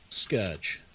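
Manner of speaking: "scourge" as SKURJ, low and deliberate; low, falling "scourge" with an UR vowel; correct pronunciation. Shown as a demonstration. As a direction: say "scourge" to rhyme with urge. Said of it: "Like, no, it's scourge".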